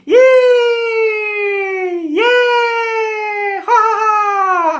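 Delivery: piercingly high, loud, drawn-out cheers; imitated gleeful shrieking. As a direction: squeal like delighted children on a thrill ride. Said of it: "ada yang teriak gini".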